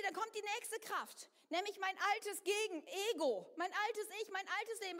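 ordinary talk, nothing marked

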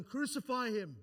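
high, loud cry imitating a mob; imitated crowd yell. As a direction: call it out like the yelling crowd. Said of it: "that was yelling".

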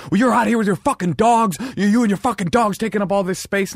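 hammed-up angry runner, high and loud; mock angry yell. Imitating the runner who yelled at him about his dogs, mock angry.

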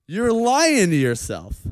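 ordinary talk, nothing marked

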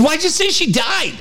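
very high mock child's protest; high, wailing kid impression; kid yelling at dad. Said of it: "yelling at me".

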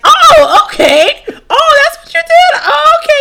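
high, squeaky overdone casualness; feigned nonchalance. Acted. Listener hiding shock at his confession, faking cool. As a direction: cover your shock with a far too high "okay". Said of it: "act like you're not affected by what he just said".

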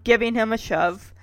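ordinary delivery

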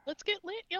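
high hyped party shout; rowdy party animal. Pretend. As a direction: hype the crowd like a partier. Mock party call, then kidding.

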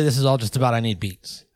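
usual speaking voice

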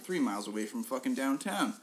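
normal talking voice

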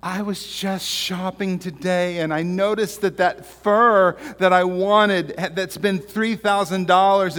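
hammed-up wife begging on the phone; pleading wife. Wife pleads to husband in joke.

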